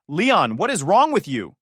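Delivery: sister's accusing shout. A narrator's reading. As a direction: yell it at him, accusing. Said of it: "she shouted".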